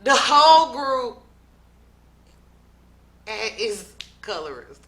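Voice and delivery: sudden burst on whole, then drops; loud retold quote. Recapping O G's claim, loud then falling off. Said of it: "O G now says".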